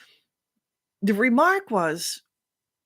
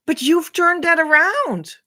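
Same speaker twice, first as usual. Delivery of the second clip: a guest bursting out higher and louder; amazed praise; 8 semitones higher, 5 dB louder than usual. Acted. Guest to the host, suddenly thrilled. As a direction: raise it up in delight at the host.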